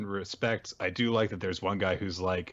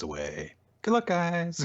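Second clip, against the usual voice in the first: tag; 10 semitones higher than usual. Acted. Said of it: "the mom of the group".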